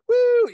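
high, loud "woo"; big showy high whoop; hyped-up whoop. Retold or imitated. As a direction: imitate a performer's stage whoop. Imitating Chris Rock's hyped stage energy.

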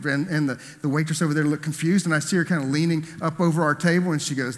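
ordinary delivery

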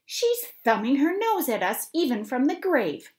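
loud, high, furious outburst; angry shout. Acted. Mother shouting at the family about the will, angry and loud. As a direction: rail at the dead aunt's insult. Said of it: "she shouted angrily".

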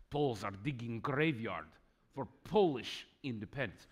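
put-on Russian accent, articles dropped. Shown as a demonstration. Lecturer redoing the Pravda quote for the audience, mock Russian accent. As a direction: read it like a Russian. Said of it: "Maybe I should do that in a Russian accent".

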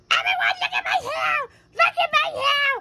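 put-on high shouted childish whine; high child's yell; shrieking kid. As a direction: shriek it for everyone to hear. Kid showing off hair.

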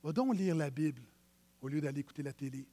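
a quiet voice urging him along; small inner voice. Pretend. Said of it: "C'est la petite voix qui dit". (petite voix douce qui pousse gentiment)

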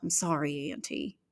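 soft, grudging low mumble; muttered apology. Acted. Girl apologizing to her aunt, soft grudging mutter. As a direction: mumble it into the floor. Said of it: "I muttered".

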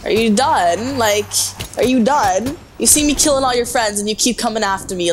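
ordinary delivery

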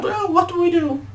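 loud, very high falsetto; frightened cartoon voice. Fleeing brother asks what to do, high loud squeal. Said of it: "fucking read that wrong".

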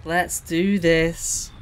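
"Let's do this" very slowly stretched; drawn out. Real, not acted. Gamer gearing up for the final mission, "Let's do this" drawn out. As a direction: stretch each word of "Let's do this".

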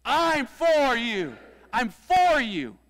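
high, slow, full-out shout; big rallying yell, words stretched out; softball team cheer. Shown as a demonstration. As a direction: holler it like cheering on a teammate. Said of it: "say it like you're on our softball team".

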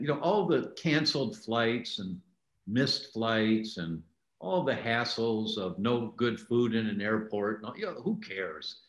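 normal talking voice

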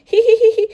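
loud, high burst of tee-hee giggling; excited giggle. Acted. Child hiding in the snow for a prank giggles loudly and high. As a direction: giggle with glee about the prank.

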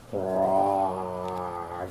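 long, low, soft pretend roar; dinosaur roar. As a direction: stretch out a deep dinosaur growl. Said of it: "dinosaur says".

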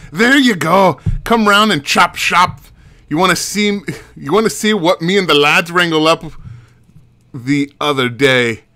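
hearty Scottish brogue; Scottish accent. Acted. Monster hunter inviting a witch round the chop shop, Scottish accent. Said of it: "Scottish works? You're okay with the Scotch".

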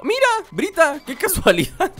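high, delighted surprise at finding her; bright pleased exclaiming; 1 excited. Real, not acted. Happy to find Brita.

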